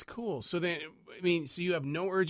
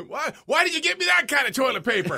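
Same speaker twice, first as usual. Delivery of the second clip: hammed-up backwoods gripe, pitched up; 12 semitones higher, 7 dB louder than usual. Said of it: "product placement".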